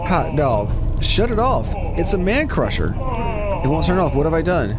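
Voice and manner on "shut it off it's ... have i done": real panic. Real, not acted. Watching the machine crush the man, real panic. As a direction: yelp as the trap goes wrong.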